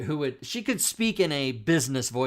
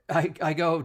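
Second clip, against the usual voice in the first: ordinary talk, nothing marked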